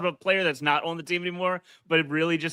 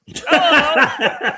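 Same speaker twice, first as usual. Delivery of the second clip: put-on high, loud old lady hello with a laugh; hammed-up old lady, louder and higher; old person voice; 10 semitones higher, 7 dB louder than usual. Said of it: "that's my old person voice".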